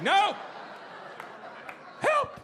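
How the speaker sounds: high-pitched cry for help; desperate plea. Acted. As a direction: shoot the voice way up, pleading.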